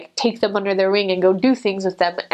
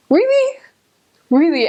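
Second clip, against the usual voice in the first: very high then falling, louder; indignant disbelief; 9 semitones higher, 5 dB louder than usual. Retold or imitated. Missionary answering the man's complaint with indignant disbelief. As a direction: shoot back in outraged disbelief. After he can't believe it.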